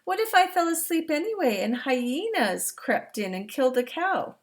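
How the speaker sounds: put-on storybook reading to young listeners; reading to children. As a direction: read it out big and warm for the class. Reads picture book aloud.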